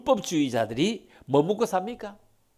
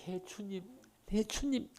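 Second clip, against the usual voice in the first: quiet, higher "예, 주님"; soft reply; 5 semitones higher, 11 dB quieter than usual. Acted. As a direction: answer the Lord softly. Believers answering the Lord softly. (주님께 조용히 응답하며)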